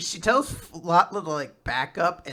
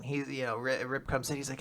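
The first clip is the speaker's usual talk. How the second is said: ordinary talk, nothing marked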